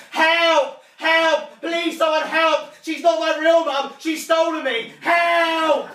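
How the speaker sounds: high, screamed cry for help; shouting at full volume. Acted. Furious child in the town centre, screaming for help. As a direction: yell for rescue at the top of your lungs. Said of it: "shouted at the top of my lungs".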